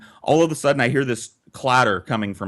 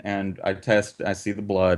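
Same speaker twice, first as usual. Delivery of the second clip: usual speaking voice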